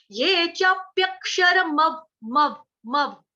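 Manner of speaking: slow ये चाप्यक्षर मव, half व; slow teacher demo; careful recitation. Shown as a demonstration. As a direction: sound out चाप्यक्षर मव very slowly. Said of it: "म पूरा आधा व".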